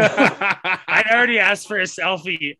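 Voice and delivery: real laughter breaking into the line; laughing. Real, not acted. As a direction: laugh it off while defending yourself. Laughs while defending himself.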